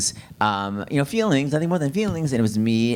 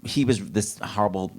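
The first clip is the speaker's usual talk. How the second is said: normal talking voice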